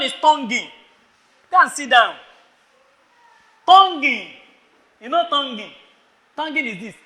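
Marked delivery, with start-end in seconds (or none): none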